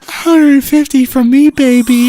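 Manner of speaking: high, loud, drawn-out come-on; English stripper. Acted. Stripper naming her price to customers, high loud English voice. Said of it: "I'm just an English stripper".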